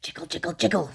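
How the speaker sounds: a teapot lid jiggling in a low voice; lid's low jiggling chant. Acted. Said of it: "said the teapot lid".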